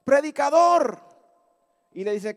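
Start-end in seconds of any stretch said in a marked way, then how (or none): 0.0-1.0 high, raised heckling call; shouting out. Retold or imitated. Hunchbacked lady interrupting the open-air preacher, shouting. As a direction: holler for the preacher's attention. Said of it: "le empezó a gritar".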